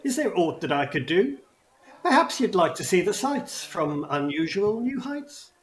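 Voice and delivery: lightly voiced goblin host, rhyming; a hint of a showman goblin greeting guests; goblin host, no accent. Goblin ride keeper greets the party, lightly voiced showman. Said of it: "a somewhat sort of Cockney accent, which I won't do".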